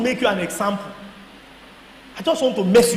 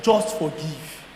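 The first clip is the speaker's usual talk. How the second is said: voice of God; 8 semitones lower, 7 dB quieter, much slower than usual. Retold or imitated. God's reply in his prayer.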